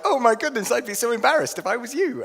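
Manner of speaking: high, loud exclamation; acting aghast for the couple; mock mortified. Acts his embarrassed reaction.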